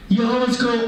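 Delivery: usual speaking voice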